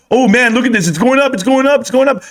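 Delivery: mock excited. Streamer mocking YouTubers who show volatile stocks, mock excited. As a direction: parody someone thrilled by a rising price. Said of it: "they're rubbing their fingers and they're just excited".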